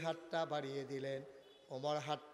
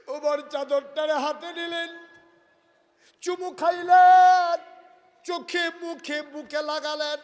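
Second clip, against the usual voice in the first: raised, ringing sermon narration; fervent preaching; 12 semitones higher, 20 dB louder than usual. Acted. Preacher dramatizing Umar's devotion to the crowd, fervent preaching.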